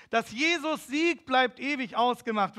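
slow, loud and high, calling over a crowd; a young man calling a hymn verse over the crowd, loud and slow; called out. Retold or imitated. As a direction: proclaim it from the church steps.

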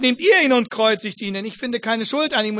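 loud Pilate's verdict to the crowd; Pilate declaring. Retold or imitated. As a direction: proclaim it over the crowd. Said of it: "Pilatus sagt".

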